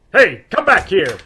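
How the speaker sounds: loud, high call after a fleeing man; shouting after him. Acted. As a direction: yell for him to stop. Chasing the fleeing gunman.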